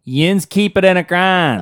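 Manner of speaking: loud, high, drawn-out Pittsburghese; Pittsburgh accent. Shown as a demonstration. Co-host correcting the article's phrase, broad Pittsburgh accent. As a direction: lay on a thick Pittsburgh accent. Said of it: "the way that we say it the right way".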